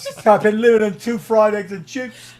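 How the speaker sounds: laughing retelling; laughing through it. Real, not acted. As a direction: giggle your way through the line. Laughs telling punchline.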